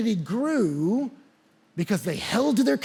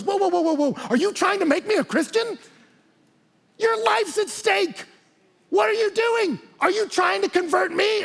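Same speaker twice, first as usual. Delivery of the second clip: very high, hammed-up incredulous outburst; alarmed king; 13 semitones higher, 4 dB louder than usual. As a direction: stop him in loud, squeaky disbelief. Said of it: "And King Agrippa goes".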